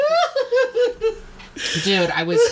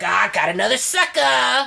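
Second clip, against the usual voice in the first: gloating scammer; 4 semitones higher than usual. Retold or imitated. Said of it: "people were like".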